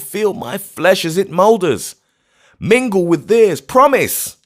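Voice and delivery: high, loud desperate pleading; anguished plea. Acted. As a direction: beg to be buried beside them. Grief-mad old man pleading.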